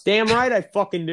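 high, worked-up retort; pissed off. Real, not acted. Host defending himself to absent Frank, pissed off. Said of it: "I'm pissed".

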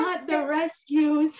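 laughing. Real, not acted. Laughing disbelief.

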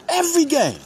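hyped. Real, not acted. Hyping his team on podcast.

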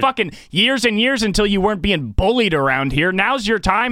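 high-pitched, indignant tirade; worked-up, high-pitched outburst; angry role-play rant. Acted. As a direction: fire back as the fed-up lot attendant. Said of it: "Let's do a role play".

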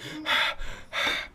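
heavy voiceless huffs; imitated panting. Sweaty student sitting in class, mock panting. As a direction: huff like a sweaty kid.

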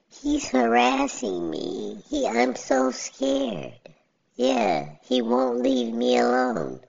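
mock scared victim. Mocking people who call the police, whiny. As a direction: whimper it like a fake victim. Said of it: "When the people be like".

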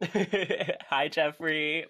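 high laughing hello. Real, not acted. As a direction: giggle through the greeting. Greeting the host with laughter.

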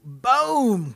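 high, loud burst; sudden booming sound effect; explosive boom. Acted. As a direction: punch it out like a blast. Acting out monster destroying Main Street.

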